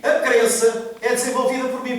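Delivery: slow, emphatic teaching delivery. Real, not acted. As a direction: spell it out slowly for the congregation. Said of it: "Eu vou falar mais devagar".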